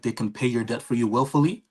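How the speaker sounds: ordinary talk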